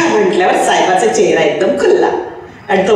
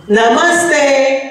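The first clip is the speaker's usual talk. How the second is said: put-on children shouting; 5 semitones higher, much slower than usual. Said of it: "पोरं खच्चून ओरडली".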